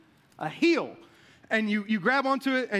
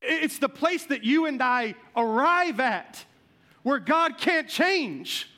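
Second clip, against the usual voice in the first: preaching; 4 semitones higher than usual. Acted. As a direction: drive the point home from the pulpit. Sermon point after the story.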